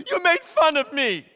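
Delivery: high, loud mock-wounded accusation; hammed-up indignant blame; accusing. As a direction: play the outraged girlfriend, loud and high. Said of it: "it's her blaming him".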